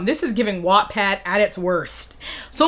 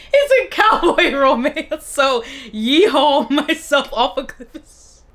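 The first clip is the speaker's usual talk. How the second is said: higher, slow words through laughter; laughing; 4 semitones higher, slower than usual. Real, not acted. Reader amused by cowboy romance review, laughing. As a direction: draw it out while cracking up. Cracking up over her review.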